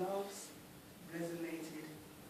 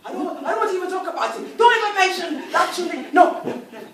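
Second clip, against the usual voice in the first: high, loud rushed refusal; husband begging his wife not to ask; frantic refusal; 8 semitones higher, 21 dB louder, much faster than usual. Acted. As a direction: shut the topic down in panic. Oba pleads with wife.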